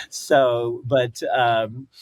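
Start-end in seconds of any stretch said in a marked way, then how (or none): none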